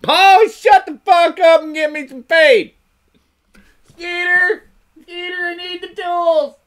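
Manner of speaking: very loud, hammed-up holler; yelling hillbilly. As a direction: scream at your kin like a fed-up yokel. Acted hillbilly yelling at Pa, Skeeter.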